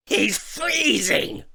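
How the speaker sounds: very high, slow squealing complaint; goblin king squeal. A narrator's reading. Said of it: "squealed the king".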